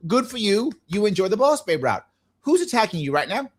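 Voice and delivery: ordinary talk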